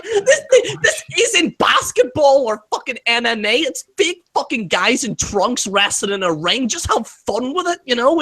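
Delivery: high, half-laughing rant; laughing exasperation. Retold or imitated. Telling commentators it's fake, laughing exasperated rant. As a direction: laugh through the disbelief. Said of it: "I'm like".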